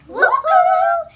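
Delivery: high, loud falsetto woo-hoo; gleeful high whoop; sung whoop. Acted. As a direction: let out a piercing sustained whoop. Whoop inside comic song.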